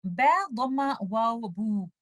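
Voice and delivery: बू stretched with a nasal hum, slow; wrongly nasalise बू. Shown as a demonstration. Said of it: "मैं इसको ऐसे पढ़ दूं बू". (बू गुन्ना के साथ)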